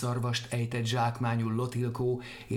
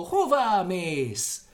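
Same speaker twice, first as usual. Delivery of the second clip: louder, slow, high Lotilkó; shouting after; 7 semitones higher, 6 dB louder, much slower than usual. Acted. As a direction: bellow Lotilkó after him. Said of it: "kiabált utána". (Lotilkó hangosan, lassan, magasan kiáltva)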